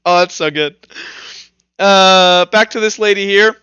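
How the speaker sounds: usual speaking voice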